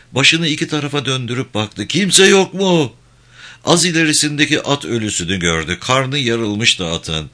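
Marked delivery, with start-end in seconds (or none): 1.9-2.9 high, slow, loud call; calling for help. Acted. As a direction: call out into emptiness for anyone. Wounded man calls for anyone. (yardım ister gibi uzatarak seslenme)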